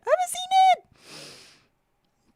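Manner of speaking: high, slow mock-anguished wail; dramatic wail. Real, not acted. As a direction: stretch it out like a lament. Repeats confession dramatically.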